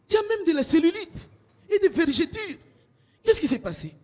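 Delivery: high, loud gossiping sheet; bed sheet gossip voice. Acted. Sheet gossiping to the pillow about her skin, high. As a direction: cattily point out her changes. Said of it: "Il m'a fait".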